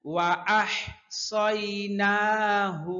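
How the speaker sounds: slow tajweed chant; Quran recitation. Shown as a demonstration. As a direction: recite the verse slowly and evenly. Recites verse slowly. (ধীর সুরেলা তিলাওয়াত)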